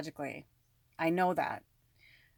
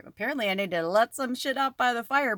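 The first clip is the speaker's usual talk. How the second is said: joking; 4 semitones higher, 5 dB louder than usual. Retold or imitated. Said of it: "But I said it through, you know, funny".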